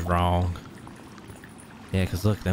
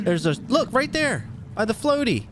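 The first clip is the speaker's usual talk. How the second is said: high panicked pointing; scared horror game streamer. Real, not acted. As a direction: shriek it out, still spooked. Pointing out the shark.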